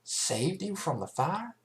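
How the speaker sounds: can't believe what she hears; incredulous Applejack. A narrator's reading. Applejack questioning the fillies, incredulous.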